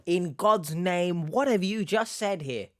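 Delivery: stretched, high-pitched dismay; baffled disbelief. Real, not acted. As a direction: draw it out in comic bewilderment. Baffled reaction to the accent claim.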